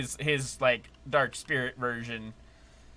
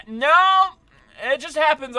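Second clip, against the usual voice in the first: high, loud startled protest; surprised; 13 semitones higher, 9 dB louder, slower than usual. Real, not acted. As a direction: blurt out a shocked denial. Game character calls him boss.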